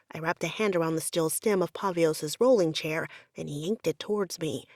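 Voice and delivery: plain reading voice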